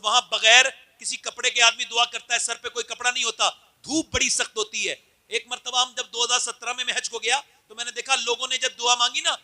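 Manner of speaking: steady preaching voice; measured Urdu sermon. Acted. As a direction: tell it from the pulpit. Preacher telling of hajj.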